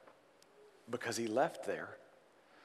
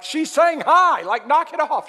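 mom's voice; 15 dB louder, slower than usual. Retold or imitated. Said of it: "his mom's like".

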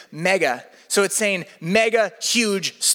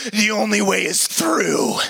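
slow, solemn coach line; a hint of grim instructor; slower than usual. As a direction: say it slowly like a grim verdict. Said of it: "She says".

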